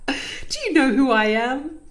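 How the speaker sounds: hammed-up arrogant doctor brushing off hippie advice; mocking her old self. Doctor mocking her past self's reply to her sister, loud and scoffing. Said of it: "And I was just like".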